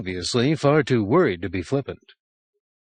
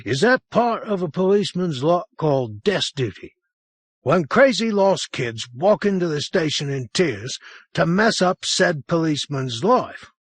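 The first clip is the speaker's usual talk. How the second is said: higher, exasperated sergeant grumbling; with feeling; 8 semitones higher than usual. A narrator's reading. Desk sergeant grumbles to the lost boy, mildly fed up. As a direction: vent a put-upon grumble. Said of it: "answered with feeling".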